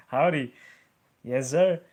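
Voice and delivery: mock cowboy politeness in Texas accent. Streamer keeps up a mock Texas accent for a Texan viewer. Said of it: "I can impersonate one".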